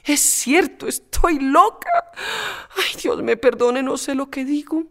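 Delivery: put-on a girl beside herself; Pepita frantic. Pepita calling herself mad, frantic and high.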